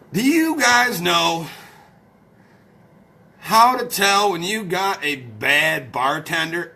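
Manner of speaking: slow, drawn-out question; slow set-up. Real, not acted. Streamer setting up a joke for followers, slow and deliberate. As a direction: stretch out each word of the question.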